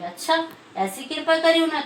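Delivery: high, astonished exclamation; amazed. Acted. Jealous sister-in-law hearing of the blessing, astonished. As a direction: gasp it out in wonder.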